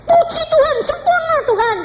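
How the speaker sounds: very high, loud cry of thanks; hammed-up jubilant squeal; retold ecstatic praise. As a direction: imitate a shrieked praise to God. Retold praise after being healed.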